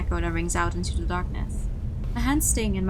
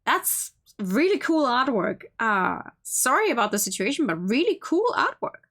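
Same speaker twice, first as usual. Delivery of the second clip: impressed aside; 4 semitones higher than usual. Real, not acted. Aside praising artwork mid-reading.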